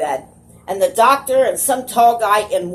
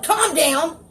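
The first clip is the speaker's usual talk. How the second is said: high, mock-yelled order; imitated yell; 8 semitones higher than usual. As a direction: imitate a doctor yelling at a patient. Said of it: "looked at me and yelled".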